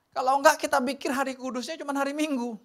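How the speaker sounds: ordinary delivery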